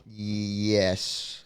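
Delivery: usual speaking voice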